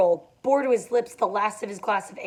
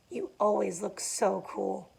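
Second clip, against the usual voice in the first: softer, slow intimate repeat; 8 dB quieter, slower than usual. A narrator's reading. Daisy to Gatsby, a soft slow lovestruck repeat. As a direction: lean in and say it softly, slowly. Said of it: "she repeated".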